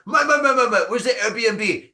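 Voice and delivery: high, loud insistent calling of Mike; tourist calling. Retold or imitated. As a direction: pester the neighbour for the Airbnb. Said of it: "if I wake up early".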